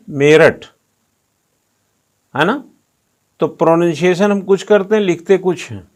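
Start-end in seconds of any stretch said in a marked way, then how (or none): 0.1-0.7 मेरठ with a clear ठ at the end. Shown as a demonstration. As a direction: say मेरठ the Hindi way. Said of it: "अब बोलते क्या हम". (मेरठ, हिंदी उच्चारण में)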